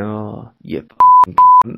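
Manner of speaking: mock-crude outburst, each word dragged; brash swearing. Imitating the barber swearing in front of his colleague. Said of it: "really brash and swearing".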